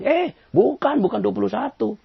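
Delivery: a stubborn man disputing the sum, hammed up; mock objection. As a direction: bark out the correction. Arguing man contradicts.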